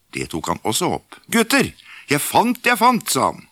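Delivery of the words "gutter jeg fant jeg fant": put-on high, eager call to the boys; excited call. Askeladden hailing his brothers about his find, high eager call. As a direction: sing out the find, delighted.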